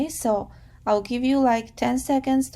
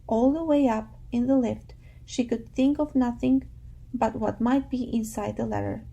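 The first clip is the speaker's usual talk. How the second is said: ordinary talk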